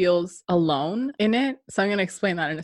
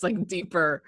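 ordinary talk, nothing marked

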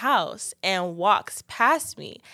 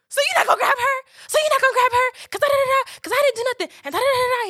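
furious crying shout; 4 dB louder, faster than usual. Retold or imitated. Shouting at boyfriend in argument.

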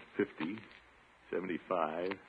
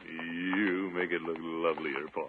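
slow, soft charmer; tender compliment; 4 semitones lower, 5 dB quieter than usual. Acted. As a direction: woo her gently. Compliments his date.

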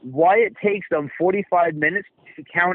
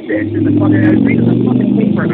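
loud rant; 6 semitones lower, 8 dB louder than usual. Real, not acted. Heated rant.

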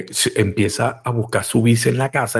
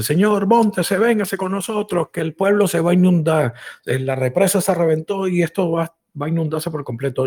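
soldier calling from the jeep, raised pitch; urgent call to climb aboard; 8 semitones higher than usual. Acted. Soldier urges the stranded man, calling out.

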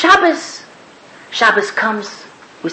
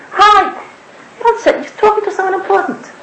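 cheery greeting; 7 semitones higher than usual. Retold or imitated. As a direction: burst in with a happy hello. Friend bursts in greeting.

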